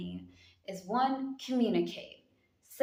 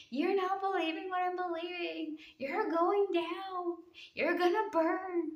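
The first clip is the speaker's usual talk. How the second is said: high, shrill-sounding preachy scold, much higher; mocking bigot voice; 11 semitones higher than usual. Vlogger mocking the bigots who judge people, high mock voice. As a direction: sneer it like a self-righteous bigot. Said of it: "hear the voices of the bigots being like".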